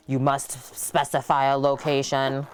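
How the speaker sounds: monotone robot voice, mechanical and even. Pretend. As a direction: read it like a machine speaking, robot voice. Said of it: "That's my robot voice".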